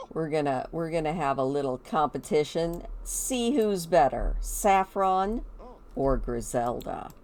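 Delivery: normal talking voice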